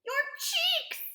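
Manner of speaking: high, loud scream of alarm; panicked mother's scream. A narrator's reading. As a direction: scream it in horror. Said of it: "screamed Missus Beauregard".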